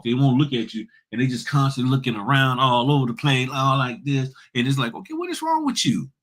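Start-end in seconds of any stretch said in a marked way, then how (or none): 4.9-6.1 much higher, exasperated what-is-wrong-with-you; incredulous mock reaction. To the trainer who won't look at him, a high incredulous squeak. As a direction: squeak it out in disbelief.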